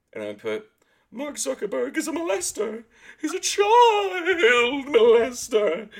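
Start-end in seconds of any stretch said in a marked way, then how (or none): 1.1-5.9 high, loud, drawn-out yell; mock shouted accusation. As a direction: belt it out, slow and shrill-pitched. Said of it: "And I posted".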